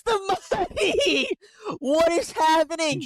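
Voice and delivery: high, slow, laughing in disbelief; can't believe it, laugh it out; incredulous laughing yell. Real, not acted.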